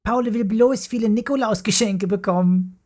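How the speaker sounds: put-on high, fast, smug girls' voice; teasing sisters. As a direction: rattle it off to tease your brother. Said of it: "grinsten Paules Schwestern Mara und Jula".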